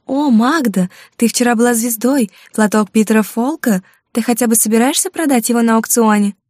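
high squeaky mockery of a gushing coworker; squeaky mimicking voice. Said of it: "писклявым голосом передразнила несуществующую коллегу". (писклявый передразнивающий голос)

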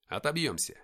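ordinary delivery, nothing marked

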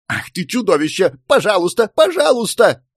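high squeal climbing on each please; outraged cry turning into shrill begging; desperate pleading. A narrator's reading. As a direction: beg to be let down, pitch rising. Stranded wife gives in, begging.

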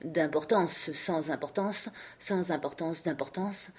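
soft, private repetition; low voice, to oneself. A narrator's reading. King testing which sounds best, quietly to himself. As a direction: try the words over quietly to yourself. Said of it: "répéter à voix basse pour lui tout seul".

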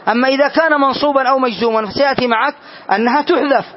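normal talking voice

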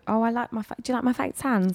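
high-pitched put-on Essex accent; playful Essex accent demo. Shown as a demonstration. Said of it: "that's supposed to be Essex".

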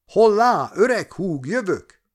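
high, loud eager shout; a bold cry of renewed youth; calling out. A narrator's reading. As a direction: hail him loudly from afar. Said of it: "kiáltott fel az öreg ügynök bácsi".

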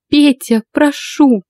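high-pitched anguished plea; pleading. A narrator's reading. Wife begging her husband, pleading. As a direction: beg in a lifted voice.

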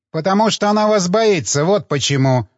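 angrily. A narrator's reading. Old actress answering the doctor, mildly angry. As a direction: snap back at him, mildly irritated. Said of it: "сердито ответила старая дама".

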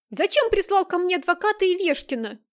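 loud, high furious demand; angry shout. Acted. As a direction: yell it in his face. Nastya confronting her father.

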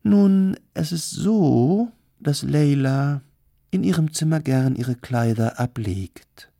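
high, loud, slow and suggestive; theatrical hostess, insinuating. Acted. Kardelin hints at a peep show, theatrical and teasing. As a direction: stretch the words out teasingly.

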